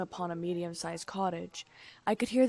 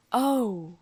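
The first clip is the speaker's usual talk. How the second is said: loud sudden realisation; startled, knowing and scared; surprised oh; 3 semitones higher, 6 dB louder than usual. A narrator's reading. Quill realising, surprised and scared. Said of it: "Quill's voice was surprised".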